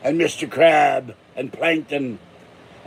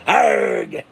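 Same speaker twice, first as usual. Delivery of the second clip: pirate growl; 4 semitones higher than usual. Pretend. Pirate growl after laugh.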